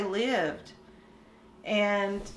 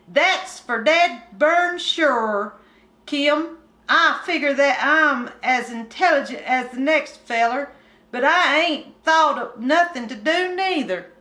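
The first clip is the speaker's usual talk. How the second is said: put-on higher, louder hillbilly boy complaining; bored country boy; 5 semitones higher, 4 dB louder than usual. Kim complaining to Winky about boredom, higher country boy voice. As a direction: whine it like a restless mountain kid.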